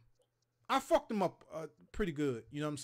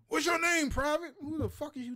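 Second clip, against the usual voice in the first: much higher, louder sergeant bark; screaming drill sergeant; 11 semitones higher, 5 dB louder than usual. Retold or imitated. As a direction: scream it in a recruit's ear like a drill instructor. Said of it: "Stop screaming in my ear".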